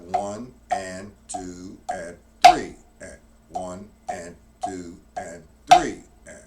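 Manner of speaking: slow, drawn-out count over the drums. Shown as a demonstration. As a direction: stretch each count out slowly. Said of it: "I'm gonna play that slow".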